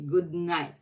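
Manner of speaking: ordinary talk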